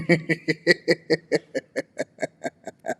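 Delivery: long run of chuckles; real amused cackle, drawn out; mocking laugh. Real, not acted. Laughing at the date's empty boasts, amused laugh.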